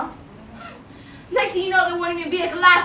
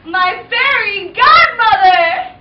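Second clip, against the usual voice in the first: slow, loud hopeful call-out; excited call; 3 semitones higher, 9 dB louder, much slower than usual. Acted. Cinderella calling for her fairy godmother, loud and slow. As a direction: sing it out to the sky.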